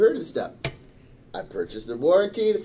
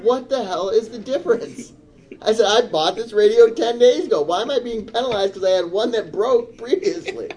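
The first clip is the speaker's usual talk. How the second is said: raised, higher voice of a furious customer; louder, higher heated rant at Best Buy staff; retold furious shouting; 7 semitones higher, 4 dB louder than usual. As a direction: replay the yelling at the clerk, loud and high. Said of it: "I get louder and louder".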